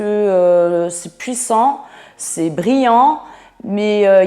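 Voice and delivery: ordinary talk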